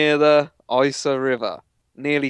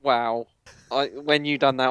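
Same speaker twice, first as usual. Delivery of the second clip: usual speaking voice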